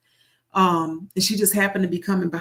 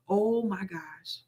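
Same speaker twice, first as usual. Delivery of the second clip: quiet awe; 8 dB quieter, much slower than usual. Retold or imitated. She is amazed.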